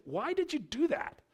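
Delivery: high, rising scolding parent voice; parent scolding kid. Retold or imitated. Pastor imitating a parent questioning a child, high and rising. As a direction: play a parent asking a child why. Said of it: "the questions you ask your kids".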